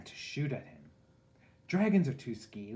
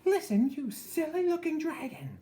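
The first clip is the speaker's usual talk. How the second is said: much higher, louder bossy yell; angry princess shout; 7 dB louder than usual. A narrator's reading. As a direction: scold the dragon shrilly high. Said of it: "she shouted".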